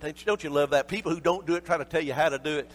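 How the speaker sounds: ordinary talk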